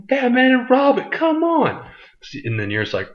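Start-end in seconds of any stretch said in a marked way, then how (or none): 0.1-1.9 squeaky, much higher, hammed-up outburst; mock exasperation. Vlogger mocking the Robin reveal in a high exasperated squeal. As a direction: shriek it like the twist was too obvious.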